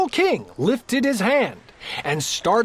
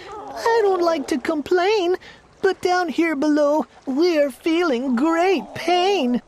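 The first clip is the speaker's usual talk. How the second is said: high, pleading voice of a crushed turtle; groaning Mac; 8 semitones higher than usual. A narrator's reading. Mac pleads from the bottom of the stack, high and groaning. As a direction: beg the king from under the stack. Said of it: "groaned Mac".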